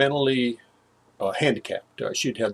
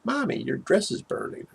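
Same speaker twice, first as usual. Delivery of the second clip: put-on childlike report; 9 semitones higher than usual. Little brother repeats the warning.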